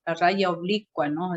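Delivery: ordinary talk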